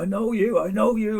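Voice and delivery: high-pitched, eager stranger's greeting; imitated excited recognition. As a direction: act out spotting someone you know. Acting the stranger recognising him.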